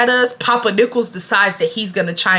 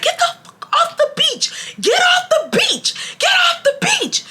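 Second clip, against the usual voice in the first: very high-pitched mock fury; hammed-up old man screeching orders; imitated angry yelling. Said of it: "So he's getting mad".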